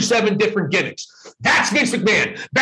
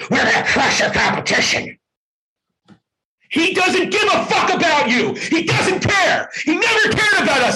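fired up; 8 semitones higher than usual. Real, not acted. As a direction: let the anger boil over at Vince. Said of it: "I'm fired up today, folks, as you can tell".

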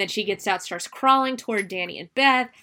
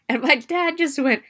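ordinary delivery

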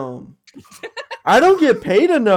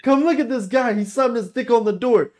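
higher, calling a coworker over to look; excited call; 8 semitones higher, 3 dB louder than usual. Pretend. Boss calling Greg over to the camera screen, eager and high. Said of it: "the higher ups looking at the cameras".

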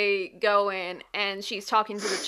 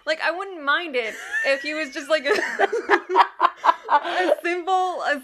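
high, loud laughing rant; 8 semitones higher, 8 dB louder than usual. Real, not acted. Laughing about ghost creep.

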